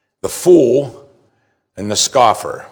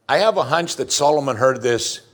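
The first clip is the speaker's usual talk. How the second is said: usual speaking voice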